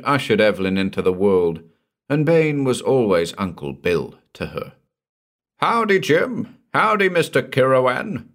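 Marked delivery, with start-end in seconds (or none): 5.6-8.3 roaring greeting. A narrator's reading. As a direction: boom out a jovial hello to old friends. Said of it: "roared Donnelly".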